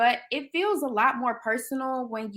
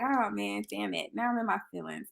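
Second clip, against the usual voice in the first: retold resignation; 8 dB quieter than usual. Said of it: "I was just like".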